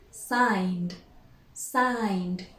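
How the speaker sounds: "signed" in one syllable, N then D; careful "signed" ending in a voiced D; silent E. Shown as a demonstration. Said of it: "we do not pronounce the E".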